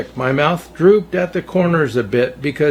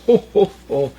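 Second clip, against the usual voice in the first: Santa laugh; 4 semitones higher than usual. A narrator's reading.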